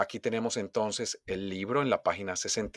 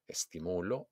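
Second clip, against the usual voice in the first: much softer "estimulo", weight on MU; stress on MU; 3 semitones lower, 10 dB quieter than usual. Shown as a demonstration. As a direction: say "estimulo" softly, leaning on MU. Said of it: "hago un golpe de voz". ("estimulo" suave, acentuado en MU)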